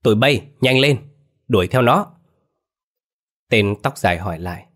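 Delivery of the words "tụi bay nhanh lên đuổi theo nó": louder, higher shouted command; rallying the gang to chase; yelling orders. A narrator's reading. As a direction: thug boss to his men, loud shout. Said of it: "la lên".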